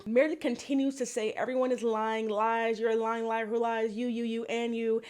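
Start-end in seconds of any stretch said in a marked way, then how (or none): 1.3-5.0 mock monotone on one held pitch; droning accusation. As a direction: drone the accusations like a tired list. Mimics Meredith's repetitive accusations.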